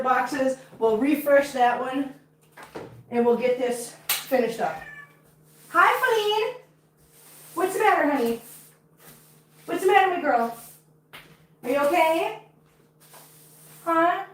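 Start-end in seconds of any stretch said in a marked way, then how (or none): 5.7-14.2 high, cooing check-in with a cat; concerned pet talk. Real, not acted. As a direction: coo questions at a beloved pet, pitched up.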